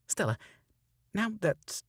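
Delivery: stumbled line. Shown as a demonstration. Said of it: "tripping up".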